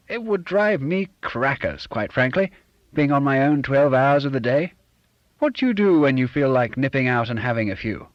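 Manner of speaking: hearty fake-posh chum; Bob's fake genteel voice. A narrator's reading. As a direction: play the smug, put-on gentleman. Bob's voice in dialogue.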